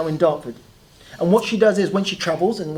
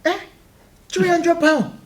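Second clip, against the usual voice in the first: high Nigerian accent impression; 12 semitones higher, 3 dB louder, slower than usual. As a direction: Nigerian accent, mum doubting the price. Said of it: "that's a better Nigerian accent".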